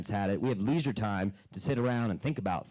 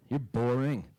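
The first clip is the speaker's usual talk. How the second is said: deadpan dad; slower than usual. Retold or imitated. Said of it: "And he'd go".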